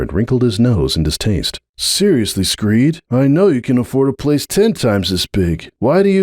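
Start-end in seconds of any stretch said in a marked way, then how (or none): 1.8-6.2 lightly voiced young colt, a bit higher, exasperated; a hint of a frustrated young stallion pushing back; slightly higher, needling nephew voice; young colt Hoops.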